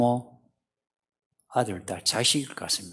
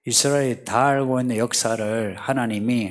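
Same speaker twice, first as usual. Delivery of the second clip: ordinary delivery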